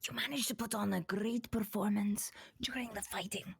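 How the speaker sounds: fast hushed whisper; conspiratorial whisper. Acted. Ava whispers a secret plan to Corgi.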